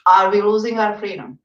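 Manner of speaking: ordinary delivery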